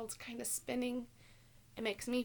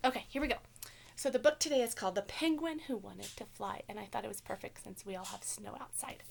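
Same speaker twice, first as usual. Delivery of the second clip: plain reading voice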